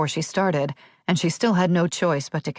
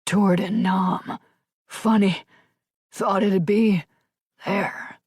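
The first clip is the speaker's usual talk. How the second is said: breathless, fading; 3 semitones higher, slower than usual. A narrator's reading. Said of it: "His breath escaped as a hiss".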